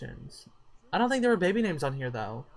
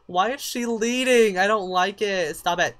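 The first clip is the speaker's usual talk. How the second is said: indignant complaint; 4 semitones higher, 7 dB louder than usual. Real, not acted. Player scolding the Sims over who leads the dance, indignant. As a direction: cry out in exasperation.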